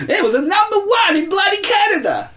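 high, laughing yell; laughing shout. Real, not acted. Host shouting the chart news through laughter. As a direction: shout it out in delight.

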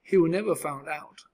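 usual speaking voice

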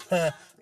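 loud, high mocking burst of laughter; imitated laugh. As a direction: mimic her laughing on the phone. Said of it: "laughing and shit".

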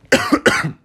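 a short dry cough; coughing fit. Real, not acted. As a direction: cough once, mid-sentence. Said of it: "Perdão pela tosse".

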